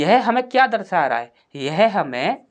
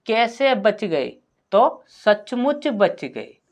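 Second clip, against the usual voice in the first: slow question and answer; 3 semitones higher, much slower than usual. Shown as a demonstration. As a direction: ask how, then answer very slowly. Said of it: "अब यहाँ पर पूछिए".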